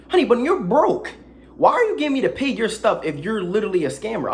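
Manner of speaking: loud, sassy callout. Real, not acted. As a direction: read her for filth.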